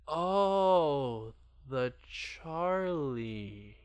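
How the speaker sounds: slow, drawn-out mock judgment on the Charlie; judgmental. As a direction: let the judgment hang on every word. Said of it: "the judgment in her voice".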